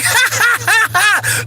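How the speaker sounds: high-pitched mock cackle; demonstrated laugh. Shown as a demonstration. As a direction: laugh tauntingly at the cat. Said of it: "Just start laughing".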